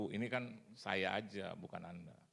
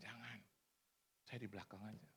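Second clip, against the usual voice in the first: humble quiet refusal; 12 dB quieter than usual. Retold or imitated. Humbly declining front seat.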